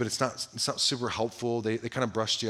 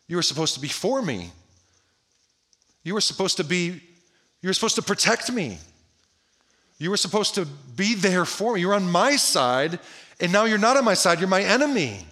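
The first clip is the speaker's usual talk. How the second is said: betrayed protest, building higher and louder; shocked dismay; 5 semitones higher, 4 dB louder than usual. Acted. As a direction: build from hurt disbelief to a loud accusation. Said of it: "like, this shock and dismay".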